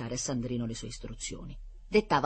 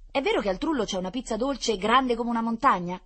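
higher, louder eager question; impatient; 9 semitones higher, 6 dB louder than usual. A narrator's reading. Blond boy butting in on Rita about the giant pizza, impatient. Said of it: "intervenne con impazienza un biondino".

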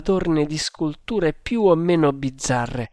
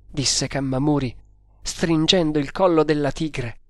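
ordinary delivery

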